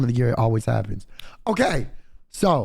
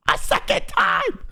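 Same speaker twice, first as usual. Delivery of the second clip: high, slow yell, still exasperated; outraged yell again. Retold or imitated. Repeating the second cancellation to friends, exasperated yell. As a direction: repeat it in disbelief.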